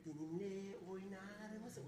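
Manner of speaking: slow, soft imitation of a soft-voiced man; soft-spoken man. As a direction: mimic his gentle voice. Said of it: "Böyle yumuşak konuşuyor".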